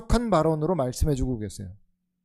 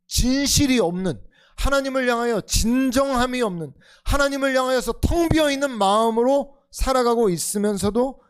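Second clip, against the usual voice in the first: 1 fervent preaching; 6 semitones higher, 5 dB louder than usual. Real, not acted. Preaching climax.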